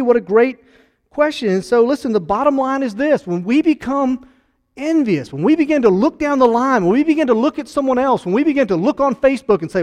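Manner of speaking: building pulpit intensity; fiery preaching. Acted. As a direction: preach it out hard to the congregation. Preaching at full force.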